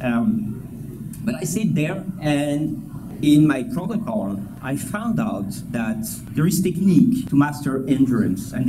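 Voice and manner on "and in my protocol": ordinary talk, nothing marked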